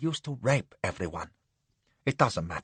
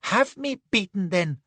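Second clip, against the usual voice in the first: much higher, sudden squeal; squealing passion; 7 semitones higher than usual. Acted. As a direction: burst out squealing. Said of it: "he squealed in sudden passion".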